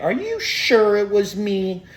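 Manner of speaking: high, slow mocking mom; mom's voice. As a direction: squeak it out like his offended mom. Mom voiced in retold argument.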